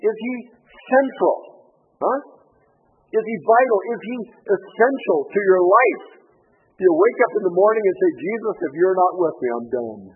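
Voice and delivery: preaching at full force. Acted. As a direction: drive the questions home to the pews. Rising appeal to the congregation.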